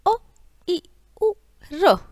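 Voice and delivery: short, separate अ, इ, उ, र, each cut off quickly; short vowels. Shown as a demonstration. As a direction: give अ, इ, उ, र the least possible time, with pauses between. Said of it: "सबसे कम समय लगता है इनके उच्चारण में". (अ, इ, उ, र का छोटा, ह्रस्व उच्चारण)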